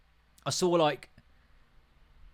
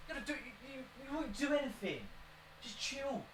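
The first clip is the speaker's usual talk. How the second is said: soft to dog; 10 semitones higher, 15 dB quieter than usual. Real, not acted. Talking down to dog.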